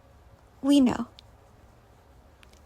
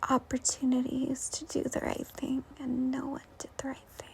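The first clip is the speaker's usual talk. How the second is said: whisper; 13 dB quieter than usual. Real, not acted. To the viewer about the past, whispered. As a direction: drop to a breathy unvoiced whisper. Confiding a painful memory.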